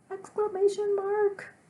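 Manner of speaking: high, loud, stretched exclamation; squealed with demonstrative excitement; very excited. Shown as a demonstration. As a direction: call it out at the top of your pitch. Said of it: "I'm very excited".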